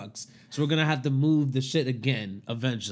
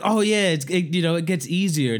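mock reassurance; 5 semitones higher, 5 dB louder than usual. Mocking coworkers' reassurance.

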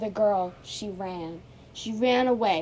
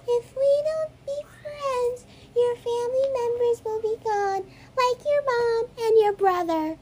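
too calm creepy doll; 6 dB louder than usual. Pretend. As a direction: coo the threat like a sinister doll. Doll threatens Emma.

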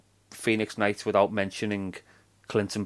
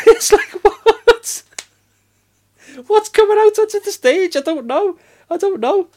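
high, baffled, disbelieving; incredulous; 6 dB louder than usual. Real, not acted. Friend recalling the Phoenix Nights joke, disbelieving laugh. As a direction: squeal in amused confusion.

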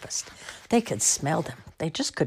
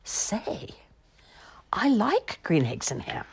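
whispered surprised discovery; amazed whisper; 6 semitones higher, slower than usual. Acted. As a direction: hush it like a secret. Discovering he likes them.

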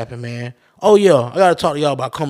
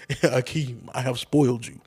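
lightly imitated stately movie king, a little softer; a hint of the royal father, amused imitation; James Earl Jones; 2 semitones lower, 5 dB quieter than usual. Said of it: "James Earl Jones in that bitch".

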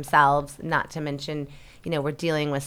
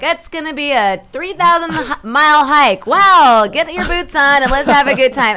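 loud, high mock rallying cry; rally hikers for a long trek, hammed-up; exaggerated upbeat pep talk; gung-ho positivity; 8 semitones higher, 10 dB louder than usual. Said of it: "to adopt some positivity".

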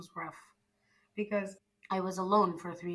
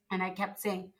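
ordinary talk

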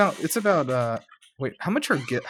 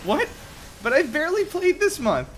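high, outraged disbelief; shocked gamer. Acted. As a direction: squeal it in disbelief. Gamer shocked at data cap.

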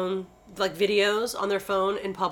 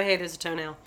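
low, soft offhand remark; casual; 6 semitones lower, 6 dB quieter than usual. Retold or imitated. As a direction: mention it as if it were nothing. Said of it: "I like the casualness".

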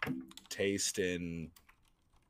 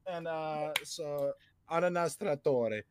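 much higher, hammed-up Italian accent; 7 semitones higher than usual. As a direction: rattle off mock Italian words. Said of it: "more of an Italian".

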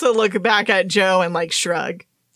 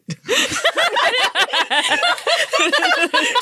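high burst of laughter. Real, not acted. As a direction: let a real laugh burst out.

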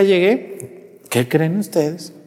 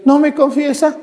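much higher mock plea; pleading parishioner; 10 semitones higher than usual. To the priest, a mock higher pleading parishioner. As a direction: imitate the pushy parishioner lightly.

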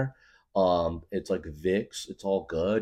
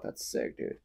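hushed awe; 10 dB quieter than usual. Retold or imitated. Quiet impressed reaction.

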